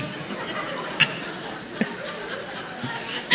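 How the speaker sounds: real airy laugh at his own joke; quiet unvoiced laugh; breathy laugh. Real, not acted. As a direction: let a silent chuckle escape.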